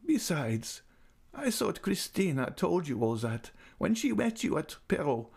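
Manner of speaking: put-on high, tender old lady's voice; fond old lady. A narrator's reading. Old lady invites Raoul near, voice high and fond. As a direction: coax him close like a loving old aunt.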